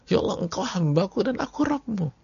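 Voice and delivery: high, thin excited quote; joyful slip. Retold or imitated. Preacher re-quoting the man's joyful slip, high and giddy. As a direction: blurt it out giddy with joy. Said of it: "udah keceplosan".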